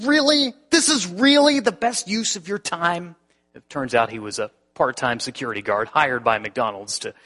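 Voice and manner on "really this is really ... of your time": high, loud, sarcastic angry retort; livid retort. Retold or imitated. Pastor angrily mocking the guard's use of his time. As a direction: throw his question back at him. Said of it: "I was livid".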